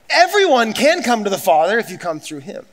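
usual speaking voice

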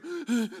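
sharp mock gasp; imitated shocked gasp. Pastor mimics congregation hearing upsetting news, sudden shocked gasp. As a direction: gasp like a stunned listener.

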